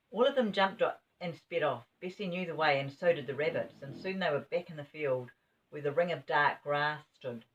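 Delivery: ordinary delivery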